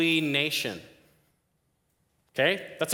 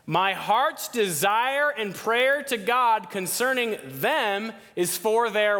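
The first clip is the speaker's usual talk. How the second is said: reading "My heart's desire and prayer to God" slowly, higher, leaning on "prayer"; slow emphatic reading; 7 semitones higher, slower than usual. Shown as a demonstration. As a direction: draw the verse out slowly with weight on "prayer".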